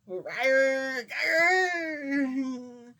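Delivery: loud, high rolling mock growl; playful bear growl. Wearing the bear hat for viewers, playful growl. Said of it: "I got on the freaking bear hat".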